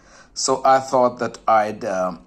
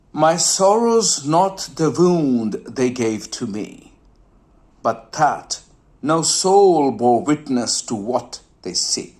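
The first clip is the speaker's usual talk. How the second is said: poem recital. Shown as a demonstration. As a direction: recite the couplet, letting "see" fade. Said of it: "now listen carefully".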